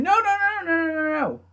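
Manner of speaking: high-pitched run of panicked no's; mock screaming. Officials trying to stop the wrestler, mock screaming. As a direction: imitate officials screaming in one high, held stream. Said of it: "all the officials screaming".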